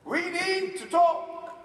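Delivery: high, loud, drawn-out summons; angry mom. Retold or imitated. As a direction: stretch it out like a stern mother. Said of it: "when she's angry".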